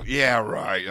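loud, scoffing yeah right; sarcastic disbelief. Retold or imitated. Teen mocking what he takes for a friend's prank call, loud scoff. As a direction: brush it off as an obvious prank.

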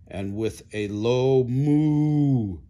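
slow, drawn-out cow moo. Acted. Buffalo moo imitated.

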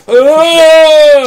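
short sharp yell of pain; scream. Pretend. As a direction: let out a sudden loud cry. Mock surgery, sudden scream.

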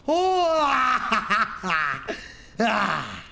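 high sudden yelp into a laugh; yelp, then laugh with relief; startled yelp. Real, not acted. Streamer nearly falling in the game, startled yelp and laugh.

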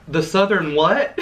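drawn-out, mock-baffled "what?"; baffled question. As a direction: imitate a puzzled phone clerk, slowly. Retold phone worker asking once more.

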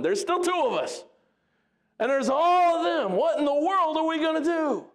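counting the odds in rising alarm; frantic servant. Acted. Servant panicking at how many enemies surround them.

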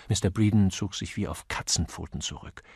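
ordinary delivery

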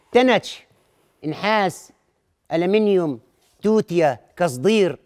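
calling out scrap metals loudly; street vendor call. Acted. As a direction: hawk the wares like a junk buyer. Scrap dealer calling out wares.

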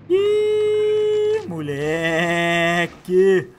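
a long squealed uh and a very slow, stretched moleque; hype squeal. Real, not acted. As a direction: cheer the big catch with a stretched squeal. Another big catch.

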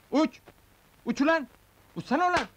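high-pitched exasperated urging; insistent high calls; impatient urging. Acted. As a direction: urge it to fly, pitched right up. Urging it to fly.

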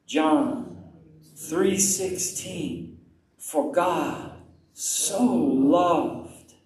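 very slow, stretched-out scripture verse; unhurried prayerful repetition; slow meditative recitation. Shown as a demonstration. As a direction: savour each word, pausing between them. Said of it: "Would you do that with me?".

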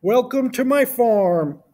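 slow, high-pitched farmer character; Farmer Brown. A narrator's reading. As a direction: welcome visitors in a drawn-out farmer voice. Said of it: "said Farmer Brown".

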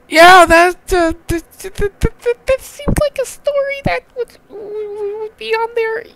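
extremely high, stammering squeak; squeaky reaction. Real, not acted. Reader conceding the story fits that wiki, squeaky stammer. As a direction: stutter it out in a falsetto.

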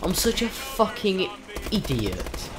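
ordinary talk, nothing marked

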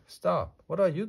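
high, loud cry of alarm; alarmed outburst. A narrator's reading. Man catching Amelia Bedelia filing his papers, alarmed and loud. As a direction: burst out to stop someone mid-task.

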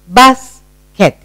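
"Basket" in two beats, pause between; split into syllables. Shown as a demonstration. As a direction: break "Basket" in half for learners. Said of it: "Two syllable word".

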